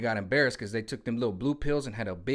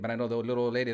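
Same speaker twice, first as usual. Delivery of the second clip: ordinary talk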